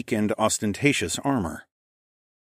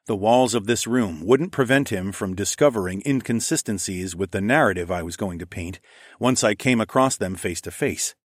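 ordinary delivery, nothing marked

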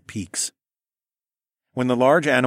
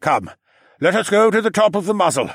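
loud higher call to group; 10 semitones higher, 5 dB louder than usual. A narrator's reading. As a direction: call them up the muzzle. Gris calling the group onward.

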